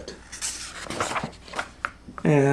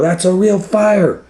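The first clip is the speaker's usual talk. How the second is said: urgent firemen; 13 semitones higher, 3 dB louder than usual. Acted. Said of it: "They go".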